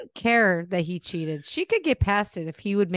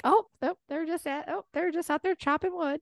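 mock naive Southern wife; 8 semitones higher than usual. Mocking wife in denial.

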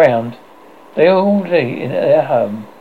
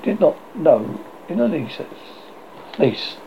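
plain reading voice

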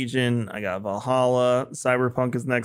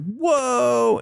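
high, loud amazed whoa; wowed by a new game; 12 semitones higher, 3 dB louder than usual. Retold or imitated. Retelling a kid's amazed reaction.

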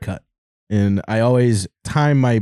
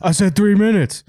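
goofy impression; 9 semitones higher than usual. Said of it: "He goes".

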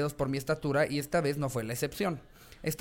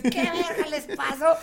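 shocked grandfather; 11 semitones higher, 4 dB louder, slower than usual. Retold or imitated. Grandfather sees the cut kids.

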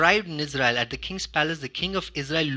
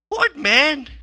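indignant what man; 9 semitones higher, 7 dB louder than usual. Retold or imitated. Frustrated outburst at God.

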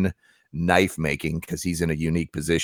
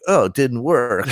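slow, higher mock-teenager whine; teenage voice; 5 semitones higher than usual. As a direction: mimic a let-down customer as a teenager. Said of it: "I need that teenage voice now".

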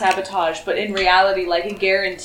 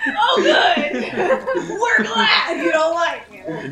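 high laughing yell; laughing shout; 9 semitones higher, 3 dB louder, much slower than usual. Real, not acted. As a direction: shout it while cracking up.

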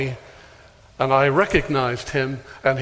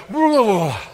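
mocking babble; 7 semitones higher, 5 dB louder than usual. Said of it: "And he went".

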